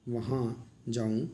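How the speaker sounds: वहाँ जाऊँ slowly, the nasal vowels drawn out; sounding out nasal words. Shown as a demonstration. Teacher showing students chandrabindu words, slow and careful. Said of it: "चंद्र बिंदु वाले हैं".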